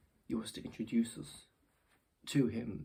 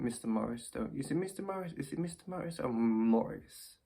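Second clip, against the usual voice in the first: ordinary delivery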